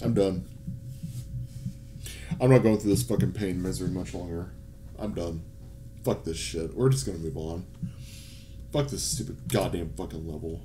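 low, soft resignation; beaten, low-voiced grumbling; defeated. Real, not acted. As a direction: give up quietly, all energy drained. Giving up on the level.

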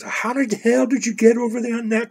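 high-pitched incredulous question; retold disbelief. Uncle and niece discuss the tower, retold disbelief.

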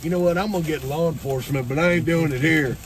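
Southern drawl. Retold or imitated. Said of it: "Southerner's like".

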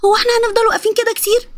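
put-on high-pitched child's voice; impatient boy. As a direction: complain like a bored kid.

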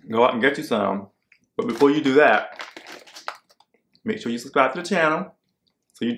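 chewing while talking; speaking between bites of popcorn; mouth full. Real, not acted. Seen 4: Popcorn taken into mouth repeatedly; speaks between bites, mouth working.

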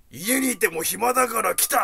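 put-on a shameless old man stating it flatly; mock old man. The old patient telling the receptionist he's just bored, mildly voiced.

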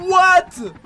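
loud, high "what?"; high outburst, falling at the end; shocked disbelief. Real, not acted. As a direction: shout it in stunned disbelief. Disbelief at video.

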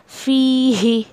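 फी ही recited very slowly; slow Quranic recitation of फी ही; tajweed recitation. Shown as a demonstration. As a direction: draw out फी ही evenly. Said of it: "ऐसे".

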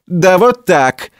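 high, drawn-out jeering answer; gloating foreman's comeback, stretched out; mocking retort. A narrator's reading. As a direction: rub it in, slow and high-pitched. Foreman mocks the newcomer.

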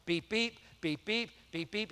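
soft mock car-alarm beeping, fading away; car alarm beeps. Preacher likens the angry officer to a car alarm, mock beeping. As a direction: beep it out like a car alarm, trailing off. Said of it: "it'd have sounded just like a car alarm".

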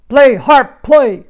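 huge loud booming shout; giant's shout. Acted. Giant shouting at the golden harp to play. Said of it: "shouted the giant".

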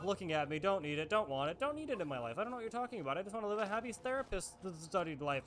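usual speaking voice

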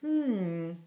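pondering hum; a considering hmm, in no hurry; thoughtful. A narrator's reading. Jonathan ponders the dragon's story, thoughtfully. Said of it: "Jonathan said thoughtfully".

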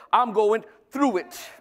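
slow, emphatic declaration of faith; drawn-out, confident proclamation; positive declaration. Acted. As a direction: declare it as someone sure of coming out the other side. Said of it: "But you should be".

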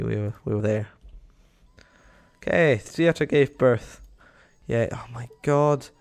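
ordinary delivery

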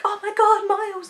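high, loud, gushing Oh my God; hammed-up excited fan calling Miles's name; mock fangirl squeal. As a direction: play the swooning fan for laughs. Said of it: "expecting me to be like".

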